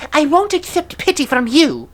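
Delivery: high-pitched scornful last words; dying villainess, defiant. Acted. Dying monster entrusting all to Yamaraja, high and defiant. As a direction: refuse pity with your final breath.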